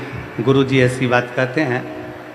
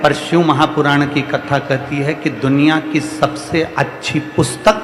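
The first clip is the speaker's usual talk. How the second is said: rising, rhythmic sermon delivery; preaching; 3 semitones higher than usual. Acted. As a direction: preach it to the crowd with swelling repetition. Guru preaching to devotees. (प्रवचन की लय में उपदेश)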